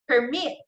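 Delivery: "permit" as a verb, per-MIT, rising; stress on second syllable. Shown as a demonstration. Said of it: "the stress there is on the second syllable".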